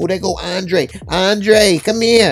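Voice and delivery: high, loud call across the room. Retold or imitated. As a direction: call him over excitedly. Cookie calling Andre over.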